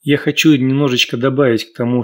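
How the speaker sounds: normal talking voice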